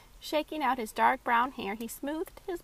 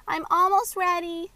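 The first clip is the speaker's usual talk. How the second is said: put-on high, loud child calling back; yelling across the room; 7 semitones higher, 9 dB louder than usual. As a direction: call it down from upstairs. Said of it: "Maxie called back".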